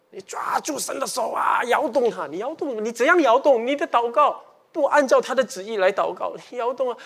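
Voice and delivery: high, loud mocking of a pestering prayer; mock pushy pleading. Preacher mocking believers who badger God in prayer, loud and high. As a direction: ham up the believer shaking God's hand.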